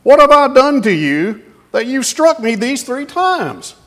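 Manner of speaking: high, loud talking-donkey voice; hurt donkey crying out to its master; pleading donkey. Acted. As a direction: squeak it out as the wronged donkey.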